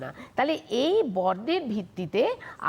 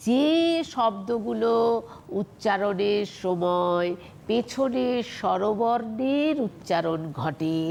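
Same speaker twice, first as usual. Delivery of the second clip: 1 slow dictation; slower than usual. Shown as a demonstration. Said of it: "slow dictation of definition".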